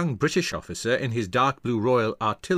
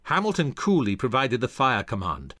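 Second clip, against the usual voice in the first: usual speaking voice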